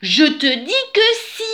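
high, loud storybook cry; exclaimed insistence. A narrator's reading. She-bear ends the quarrel with the dirty bear, exclaiming. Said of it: "s'écria la jolie ours toute propre".

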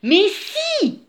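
high, insistent storybook retort; mildly growled insistence. A narrator's reading. She-bear argues back at the dirty bear, mildly growling insistence. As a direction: insist with a touch of a grumble. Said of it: "grogna la jolie ours toute propre".

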